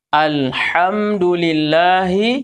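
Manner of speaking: Alhamdulillahi slow, with a breathy throat h; careful recitation; slow correct recitation. Shown as a demonstration. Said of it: "jangan dibaca alhamdulillah".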